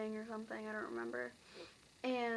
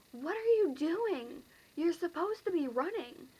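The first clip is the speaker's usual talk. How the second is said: exasperated call; 6 semitones higher, 5 dB louder than usual. Retold or imitated. Urging frozen classmates.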